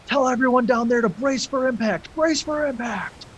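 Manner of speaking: high, urgent yell to the crew below; shouted order to brace; captain yelling orders. Acted. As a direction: bark it down the hatch like a panicked captain. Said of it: "he yells down to one of the crew".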